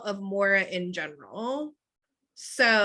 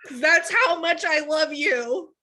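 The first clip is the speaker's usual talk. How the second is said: earnest fan; 6 semitones higher, 3 dB louder than usual. Retold or imitated. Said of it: "I would be like".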